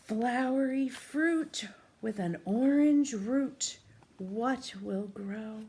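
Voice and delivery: put-on slow, sing-song rhyme for a child; riddle read-aloud. A narrator's reading. Teacher reads the rhyme to a young child, slow sing-song. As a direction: chant the riddle slowly to a little listener.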